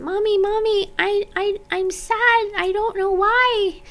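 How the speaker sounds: put-on high, small child's voice pleading to mom; little girl voice. Four-year-old telling her mom she's sad, in a high little-girl voice. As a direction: play yourself at four, coming to mommy sad. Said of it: "I would go up to my mom".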